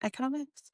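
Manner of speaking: "Economics" with a short e, rising like a question; questioned pronunciation. Shown as a demonstration. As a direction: try out "Economics" softly, unsure of its first vowel. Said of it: "why I keep calling it economics".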